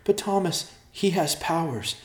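high-pitched, urgent warning; frightened, high-pitched plea to listen; alarmed warning. A narrator's reading. Distraught sister warns her brother.

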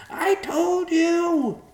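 very high, loud, drawn-out squawk; crying-out vulture. A narrator's reading. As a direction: shriek it slowly as a panicked bird. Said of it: "the vulture cried".

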